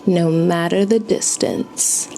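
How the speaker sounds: usual speaking voice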